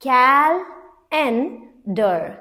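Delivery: Calendar drawn out very slowly, syllable by syllable; slow syllable split. Shown as a demonstration. Teacher slowly sounding out Calendar for students. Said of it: "जो ए है, ये एह sound नहीं कर रहा है".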